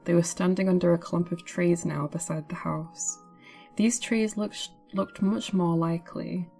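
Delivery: ordinary delivery, nothing marked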